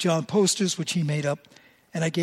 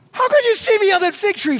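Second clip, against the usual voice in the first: much higher, louder astonished question; amazed disbelief; 8 dB louder than usual. Acted. Nathanael stunned that Jesus saw him, high astonished cry. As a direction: gasp it out like you can't believe it.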